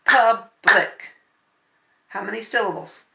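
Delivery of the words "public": Public clapped as pub-lic; teacher clapping Public for the kids; syllable clapping. Shown as a demonstration. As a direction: clap out Public. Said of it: "let's clap".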